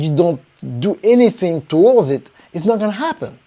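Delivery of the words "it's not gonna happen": ordinary talk, nothing marked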